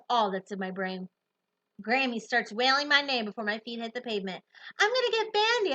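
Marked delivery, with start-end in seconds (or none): 4.8-5.7 high called-out promise; shouting back. Acted. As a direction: shout back to Grammy while running. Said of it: "I shout back to her".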